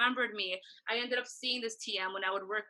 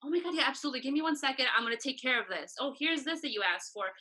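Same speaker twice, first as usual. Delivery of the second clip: higher-pitched retold eagerness, easing down; eager helper; 4 semitones higher, faster than usual. Assistant offering the band anything else, eagerly acted. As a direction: jump at the chance to help.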